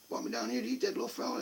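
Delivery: ordinary talk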